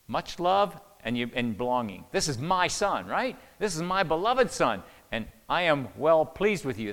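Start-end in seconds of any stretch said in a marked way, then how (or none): 2.1-6.9 loud, stressed reading of the Father's words, beloved leaned on; booming fatherly declaration. Shown as a demonstration. Preacher proclaiming the Father's words to Jesus, loud and emphatic. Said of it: "That's significance".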